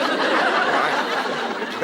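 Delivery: long high wheeze of laughter; real laugh. Real, not acted. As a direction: burst out laughing. Laughing at own story.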